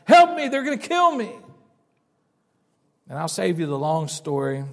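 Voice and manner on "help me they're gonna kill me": high, loud, panicked screams; woman screaming for help. Retold or imitated. Woman running down the dark road, screaming for help. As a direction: scream as you run for your life.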